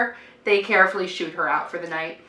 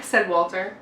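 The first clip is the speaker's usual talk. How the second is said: plain reading voice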